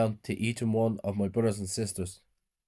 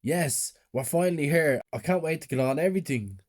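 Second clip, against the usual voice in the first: higher, eager, lightly raised voice; excited shouting; 6 semitones higher than usual. A narrator's reading. Child arrives at the carnival, mild excited shout. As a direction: cheer it without a full shout. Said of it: "shouting, saying".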